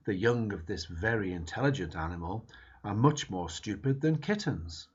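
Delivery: ordinary talk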